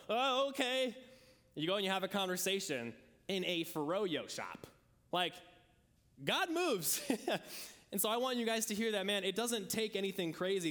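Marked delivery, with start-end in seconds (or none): 0.1-0.9 higher, caught-off-guard okay; startled okay. Retold or imitated. Believers pushed out by God, startled higher okay. Bird kicked out of nest. 6.2-7.0 much higher, lifted declaration; excited exclamation. Real, not acted. Preacher marvelling at the froyo conversions, high excited voice. Climax of his story.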